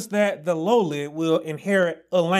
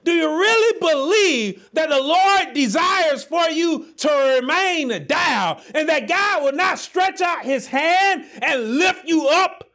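preaching at full force; 6 semitones higher than usual. Acted. Preacher shouting hope to his congregation, fervent.

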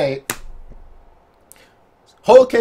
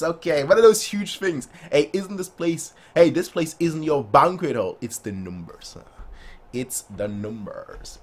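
ordinary talk, nothing marked

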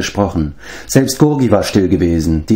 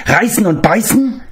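high creature voice, question rising at the end; prompting creature; 13 semitones higher than usual. Acted. Gurgi reminds Gwydion of his reward, high hopeful creature voice. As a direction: nudge them with a hopeful little reminder. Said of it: "brachte Gurgi sich in Erinnerung".

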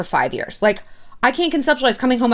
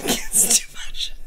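a slow, airless whisper worn out by laughing; breathless whisper; 6 dB quieter, much slower than usual. Real, not acted. After laughing fit, slow breathless whisper. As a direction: barely get it out, spent from laughing.

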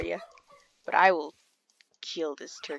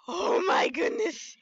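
high, slow frightened exclamation; real spooked cry, pitched up; scared; 12 semitones higher, 4 dB louder than usual. Real, not acted. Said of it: "That scared me".

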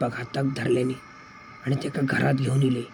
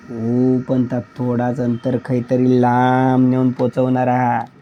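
put-on loud, slowly stretched words of an old man; mysterious old villager; 8 dB louder, slower than usual. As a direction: draw out the long words loudly, like an old guide. (गूढ म्हाताऱ्याचा मोठा, लांबवलेला आवाज)

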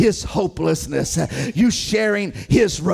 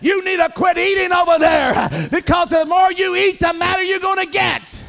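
full-force sermon cadence; fiery preaching; 8 semitones higher than usual. Acted. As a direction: preach it hard to the congregation.

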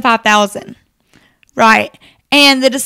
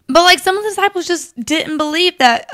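ordinary talk

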